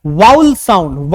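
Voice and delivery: ordinary talk, nothing marked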